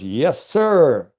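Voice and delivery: lightly mimicked TV commentator's excited yes sir; a hint of the broadcaster calling the putt; Vern Lundquist's call. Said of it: "Vern Lundquist goes".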